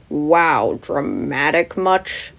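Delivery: low, slow, miffed drawl; sarcastic. Acted. Teacher mocking a flashy entrance, slow and sarcastic. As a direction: roll the eyes at the showy entrance. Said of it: "says sarcastically and clearly miffed".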